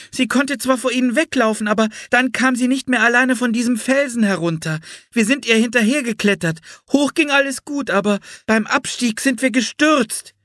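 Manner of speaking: put-on high-pitched little girl's voice; young Anne, earnest. A narrator's reading. Anne explains the pet's rescue to Florence, high girlish voice. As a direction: pipe up with an eager explanation.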